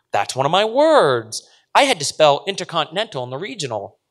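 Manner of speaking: put-on high, eager child's voice; proud little girl. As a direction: boast a little like a kid.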